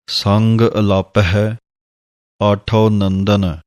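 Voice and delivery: slow, phrase by phrase, after the ਸੰਗ pause; paced recitation. Shown as a demonstration. Teacher recites the phrasing slowly for students. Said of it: "ਇਸ ਤਰ੍ਹਾਂ ਇਹ ਪਾਠ ਹੈ".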